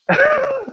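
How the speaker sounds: a quick high laugh at his own joke; high burst of laughter. Real, not acted.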